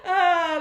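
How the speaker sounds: loud high burst of laughter; real laugh. Real, not acted. Host laughing at a viewer laughing at her, real laugh. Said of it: "someone's laughing at me now".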